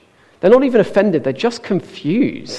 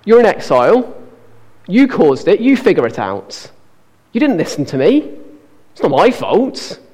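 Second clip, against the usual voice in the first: harsh scolding God; 2 semitones higher, 4 dB louder than usual. Pretend. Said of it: "So God could say to the Israelites".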